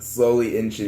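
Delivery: normal talking voice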